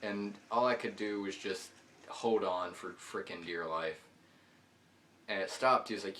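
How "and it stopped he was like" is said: ordinary talk, nothing marked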